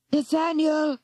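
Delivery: high urgent call. Acted. Girl calling urgently across the mist. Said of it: "came an urgent voice".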